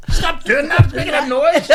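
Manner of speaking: high, loud, through laughter; telling the co-host to stop while laughing. Real, not acted. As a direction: giggle the words out.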